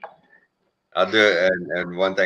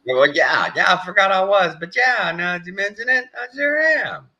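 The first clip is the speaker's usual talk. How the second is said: high, louder whiny dog voice; voicing the dog; 5 dB louder than usual. Speaking for the cold dog Butter, high whiny voice. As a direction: answer as the shivering pup.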